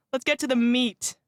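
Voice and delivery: normal talking voice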